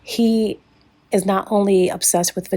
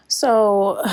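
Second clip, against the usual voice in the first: ordinary talk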